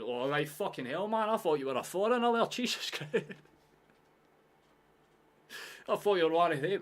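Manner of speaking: loud, high Glaswegian outburst, breaking into laughter. Acted. Stranger learning you aren't a foreigner, loud Glaswegian exclamation. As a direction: blurt it out as a surprised Glaswegian. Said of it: "hear the Glaswegian and change their attitude".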